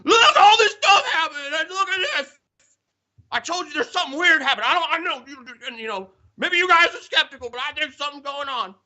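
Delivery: shrill-pitched, loud mock panic; high mock screaming. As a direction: yell it like a spooked friend, half laughing. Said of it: "He's out there screaming".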